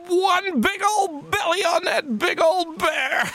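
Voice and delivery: very high, goofy put-on voice; high comic bear talk; playful silly voice. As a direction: ham it up to delight your son. Father joins the game playfully.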